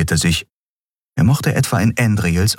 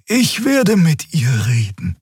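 much higher, slow hushed reassurance; Xea half-whispered; 11 semitones higher, slower than usual. A narrator's reading. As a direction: whisper it high and gentle. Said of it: "Xea reassures quietly".

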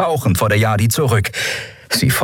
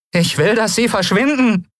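put-on high-pitched boy's voice; nervous demand. Boy ordering the pirate away, high and nervous. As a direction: stand up to her despite fear.

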